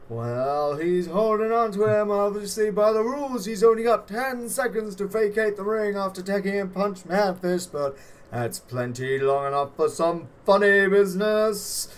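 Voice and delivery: high, loud, put-on officiating voice; referee. Game master as the referee ruling on the hold, loud high character voice. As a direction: call it out high and loud over the ring. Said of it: "referee is like".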